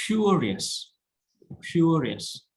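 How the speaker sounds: curious with CURE stressed. Shown as a demonstration. Teacher modelling for class, stress on cure. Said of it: "stress on cure".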